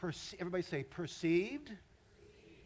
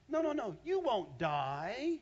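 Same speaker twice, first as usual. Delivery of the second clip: slow, coaxing tempter voice, higher and louder; sly serpent; 7 semitones higher, 6 dB louder, much slower than usual. Acted. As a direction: draw her in slowly, sweet and sly. Satan reassuring Eve.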